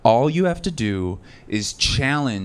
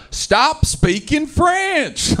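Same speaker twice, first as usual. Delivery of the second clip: much higher, louder, slow drawn-out yell; 4 dB louder, much slower than usual. Retold or imitated. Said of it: "a redneck be like".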